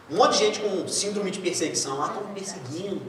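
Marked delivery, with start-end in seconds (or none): 2.0-3.0 softer, whiny mock of a paranoid person; mock paranoid complaint. Preacher mocks a paranoid churchgoer, soft whiny mimicry. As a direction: mimic someone who feels persecuted.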